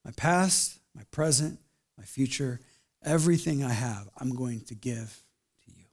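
soft, slow, fading surrender; quiet surrendering prayer; humble prayer. Retold or imitated. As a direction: give your life over, hushed and slow. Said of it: "a posture of saying".

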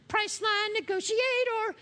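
high mock TV announcer. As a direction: boom it like a commercial tagline. Announcer-style ad voice, far, high.

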